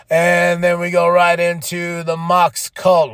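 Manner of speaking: ordinary delivery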